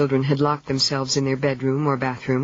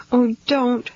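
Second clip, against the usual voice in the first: sobbing; 8 semitones higher, 3 dB louder than usual. A narrator's reading. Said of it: "she sobbed".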